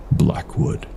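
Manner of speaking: ordinary delivery, nothing marked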